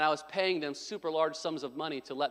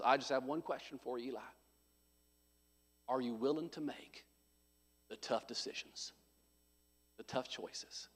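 put-on Texas accent; 6 semitones lower, 12 dB quieter than usual. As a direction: quietly imitate a grave Texan, pausing between phrases. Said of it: "he's got that thick Texas accent".

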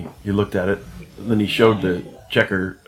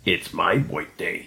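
slow, drawn-out cartoon character; lightly voiced slow cartoon guy; cartoony voice; 2 semitones lower, 4 dB quieter, slower than usual. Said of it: "this little cartoony voice".

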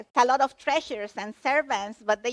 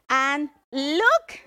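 "look" drawn out, louder and rising; stress on "look"; 3 semitones higher, 5 dB louder than usual. Shown as a demonstration. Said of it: "I'm going to stress the word "behold".

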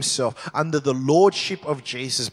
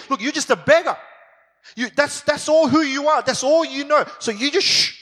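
scornful crowd telling beggar off, higher; mocking crowd; 9 semitones higher, 3 dB louder than usual. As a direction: hush him with contempt. Crowd silencing the blind beggar.

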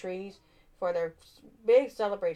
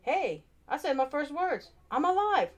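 excited snowman; 8 semitones higher than usual. A narrator's reading. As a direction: burst out with delight at being alive. Frosty comes to life.

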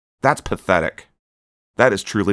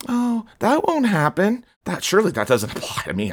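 mocking listener; 11 semitones higher than usual. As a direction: drag it out like a complacent listener, much higher. Parodying listeners' denial.

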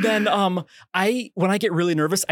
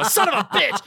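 higher, laughing curse; 9 semitones higher than usual. Retold or imitated. Looking down at himself, laughing in disbelief.

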